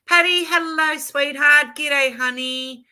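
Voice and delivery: high, loud sweet hello; gushing greeting. Real, not acted.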